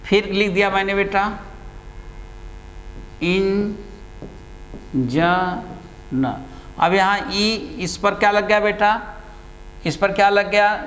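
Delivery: usual speaking voice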